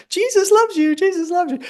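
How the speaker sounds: very high squeaky toy; talking teddy bear. Acted. Author warns her audience about the talking teddy, squeaky. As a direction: chirp it like a pull-string toy. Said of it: "there's going to be a teddy bear that says".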